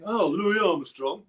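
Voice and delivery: a hint of Dad shrugging off a famous player; imitating dad. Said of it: "I remember my dad saying".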